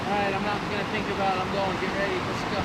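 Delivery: bracing for the cold; nervous pump-up before jumping in; psyching himself up. Real, not acted. Psyching up before ice bath.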